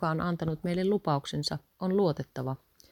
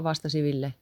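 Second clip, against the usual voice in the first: ordinary delivery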